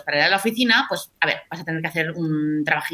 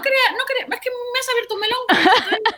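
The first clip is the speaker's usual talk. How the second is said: laughing. Real, not acted. Laughs at own outburst.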